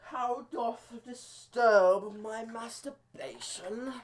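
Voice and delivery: slow, high, drawn-out villain voice; Father. Acted. Comedian voicing Father in an after-credit scene, slow high put-on voice. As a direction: stretch every word out high and grand. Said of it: "It'll be like Father. He'll be like".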